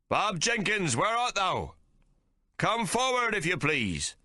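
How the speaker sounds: high, calling out to the crowd; commanding captain. A narrator's reading. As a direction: summon him over the heads of the men. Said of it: "he commands".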